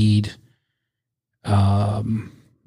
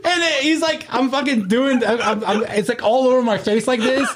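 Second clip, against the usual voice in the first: laughing while retelling; 12 semitones higher than usual. Real, not acted. Retelling his own high, cracking up.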